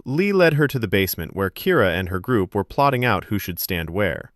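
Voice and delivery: ordinary talk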